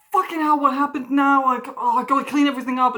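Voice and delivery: loud, panicked cursing; swearing in a sudden panic; freaking out. Retold or imitated. Water spilled on the desk, loud panicked swearing. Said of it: "was completely freaking out".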